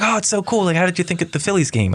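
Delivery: high gushing excited fan voice; mock starstruck bar crowd; American bar fans gushing. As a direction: gush like an impressed local.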